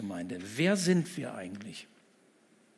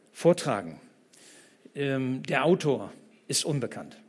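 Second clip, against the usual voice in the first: usual speaking voice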